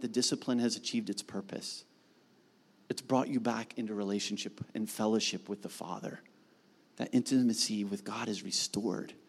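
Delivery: hushed, intimate pastoral voice; tender soft preaching. Acted. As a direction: bring it down low and gentle for the flock.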